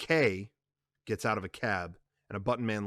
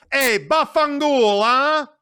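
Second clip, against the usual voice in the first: loud, slow, higher; contemptuous curse; 11 dB louder, slower than usual. Acted. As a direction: sneer the Italian curse. Carlo curses Connie.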